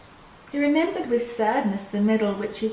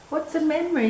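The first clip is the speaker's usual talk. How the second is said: put-on raised childlike asking voice; childlike question; 7 semitones higher, faster than usual. Little boy asking an old neighbour, voice raised. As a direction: ask it like a curious little boy.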